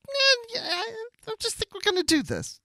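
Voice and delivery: dismissive Janeway. Retold or imitated.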